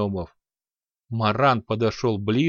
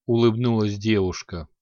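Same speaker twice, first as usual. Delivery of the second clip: ordinary delivery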